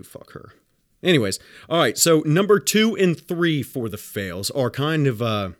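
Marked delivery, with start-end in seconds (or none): 0.0-0.5 whispered curse. Retold or imitated. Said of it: "Told the office too".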